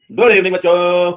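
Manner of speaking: usual speaking voice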